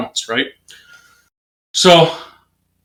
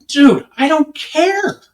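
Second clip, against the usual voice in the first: high, drawn-out mock exasperation; retold exasperation; 11 semitones higher, much slower than usual. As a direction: stretch it out high, waving it off. Retold officer waves off the weed.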